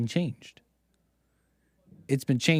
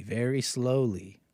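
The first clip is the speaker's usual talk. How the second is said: stretched out, very slowly drawn; 5 semitones lower, 5 dB quieter, slower than usual. Shown as a demonstration. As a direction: let very slowly crawl out, word by word. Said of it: "means to play very slowly".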